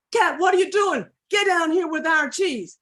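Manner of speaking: loud, high yell up a tree; alarmed shout. Acted. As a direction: holler at the thieving cat. Dog yells up at Cat.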